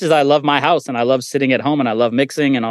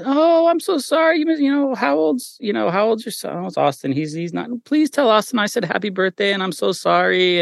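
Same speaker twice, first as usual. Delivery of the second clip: high sweet voice full of apology; 9 semitones higher than usual. Retold or imitated. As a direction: mimic a gentle, high, apologetic superstar. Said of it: "And [name] said something like".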